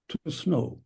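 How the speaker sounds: normal talking voice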